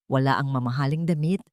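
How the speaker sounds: usual speaking voice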